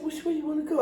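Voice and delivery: put-on high, soft, come-hither girlfriend.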